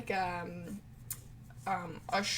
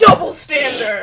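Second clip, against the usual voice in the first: loud emphatic; 4 semitones higher, 16 dB louder than usual. Acted. Joke echo, loud.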